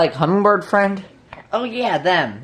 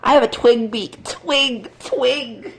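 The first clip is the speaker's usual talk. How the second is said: ordinary delivery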